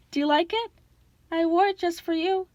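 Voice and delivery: high, coy bride voice; falsetto. A narrator's reading. Grover plays bride to the monster, coy falsetto. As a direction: flirt in a put-on falsetto. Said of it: "Grover said in his falsetto voice".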